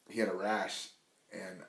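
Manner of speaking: ordinary talk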